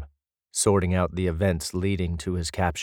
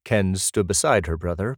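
ordinary delivery

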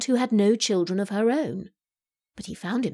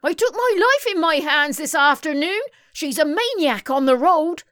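high, outraged complaint; indignant accusation; 9 semitones higher, 4 dB louder than usual. A narrator's reading. Muriel accuses the maniac driver, indignant. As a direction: accuse her, pitched up and forceful.

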